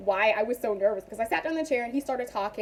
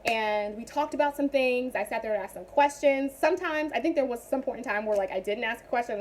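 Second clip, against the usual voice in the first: normal talking voice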